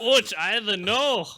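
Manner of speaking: high, slow Scottish burr; exaggerated Scottish lilt; mock Scottish accent. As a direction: imitate a Scot, drawn out and loud. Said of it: "what is Musley saying".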